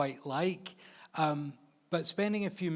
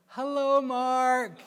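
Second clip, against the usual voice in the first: loud, high mock greeting; hammed-up high-pitched greeting; Melissa McCarthy; 10 semitones higher, 7 dB louder, much slower than usual. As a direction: sing out an exaggerated hello, pitched way up. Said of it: "And she's like".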